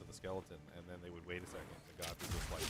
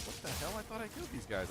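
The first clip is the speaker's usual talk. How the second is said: baffled disbelief; 9 semitones higher than usual. Retold or imitated. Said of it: "And I was like".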